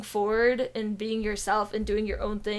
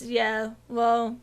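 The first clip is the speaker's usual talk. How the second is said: normal talking voice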